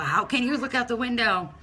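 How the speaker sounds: normal talking voice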